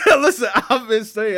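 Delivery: loud, high, laughing protest; laughing insistence. Real, not acted. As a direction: insist through laughter, voice jumping up. Laughing insistence on being heard.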